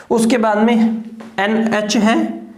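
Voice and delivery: ordinary talk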